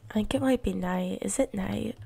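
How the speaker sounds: ordinary talk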